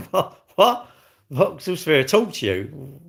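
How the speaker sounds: can't believe what he read; laughing disbelief. Real, not acted. Streamer reacting to a chat comment in laughing disbelief.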